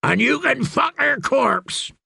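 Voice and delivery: high, drawn-out goading; urging. A narrator's reading. Dornishman tempting the fool to attack, urging. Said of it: "urged Timian".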